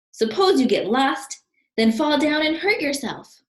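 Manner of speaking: fretting Wallace. Acted. Wallace warning Albert, high and anxious. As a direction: spin out the worst case anxiously.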